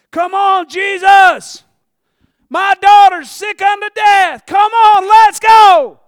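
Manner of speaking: high, loud desperate urging; urgent shout. Retold or imitated. Preacher acting Jairus clearing the way for Jesus, urgent shout. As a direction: yell for him to hurry through the crowd.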